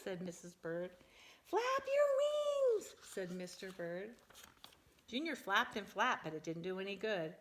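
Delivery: lively, animated picture-book reading; reading to children. Acted. Parent reading a silly bird book to her child, animated. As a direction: read it big and bouncy for a small child.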